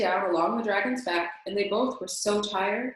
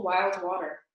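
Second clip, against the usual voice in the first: usual speaking voice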